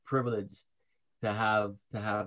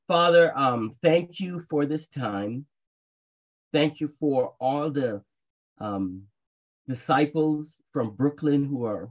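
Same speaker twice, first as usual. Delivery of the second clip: earnest, louder prayer voice; fervent prayer; 4 dB louder than usual. Acted. Preacher leading the congregation in opening prayer, fervent. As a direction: pour it out to God.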